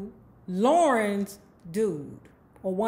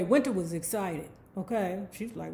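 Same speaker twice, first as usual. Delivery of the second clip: ordinary talk, nothing marked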